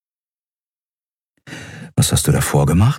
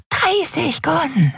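creepy price pitch; much slower than usual. Acted. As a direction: coax it again, drawn out. Dealer wiederholt seinen Preis. (sehr hoch, langsam, leiser wiederholt)

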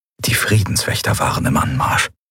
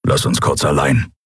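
low Skrier growl; growled order; 4 semitones lower than usual. A narrator's reading. Skrier sends the girl away, low growl. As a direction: growl the order in a lowered pitch. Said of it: "knurrte er".

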